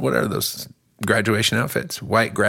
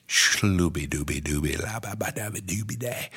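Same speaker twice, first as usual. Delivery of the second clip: nonsense language; 3 semitones lower, 7 dB quieter than usual. Retold or imitated. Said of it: "sounds like".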